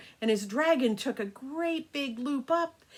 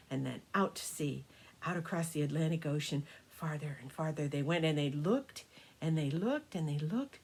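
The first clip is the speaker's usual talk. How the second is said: lower, softer storytelling drifting far; hushed narration; 7 semitones lower, 7 dB quieter than usual. A narrator's reading. Grandma telling the long flight over the ocean, low hushed voice. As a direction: let the voice sink as the sea stretches out.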